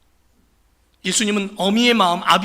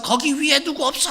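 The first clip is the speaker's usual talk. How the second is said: frightened cry for help; 7 semitones higher than usual. Acted. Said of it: "겁에 질린 그 사람 소리를".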